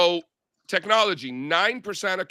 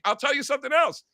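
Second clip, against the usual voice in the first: much higher, gleeful one-upping; excited tease; 10 semitones higher than usual. Retold or imitated. Teasing Catherine off air, high gleeful tease. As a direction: keep the hype going. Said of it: "I'm like".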